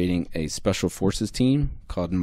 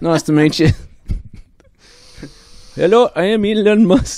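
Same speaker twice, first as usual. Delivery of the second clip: high, loud hammed-up Australian; Elon Musk, Australian accent; 9 semitones higher, 6 dB louder than usual. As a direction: introduce yourself in a bad Australian accent. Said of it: "let's hear him talk".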